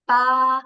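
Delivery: "Ba" said high, flat and strong as Mandarin first tone; a high level "Ba". Shown as a demonstration. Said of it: "the high and flat pitch".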